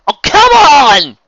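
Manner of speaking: high, drawn-out Scots wail of protest; exasperated yell. Acted. Villain whose name the boy should know, exasperated yell. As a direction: bellow it long in disbelief.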